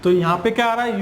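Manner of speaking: usual speaking voice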